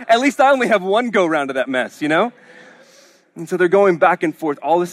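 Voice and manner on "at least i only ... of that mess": laughing Lazarus. Retold or imitated.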